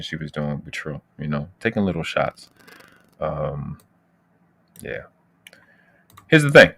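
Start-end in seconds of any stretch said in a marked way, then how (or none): 6.3-6.7 louder, punchy "Here's the thing"; emphatic call. Real, not acted. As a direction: slam down "Here's the thing" to get attention. Repeats phrase to grab attention.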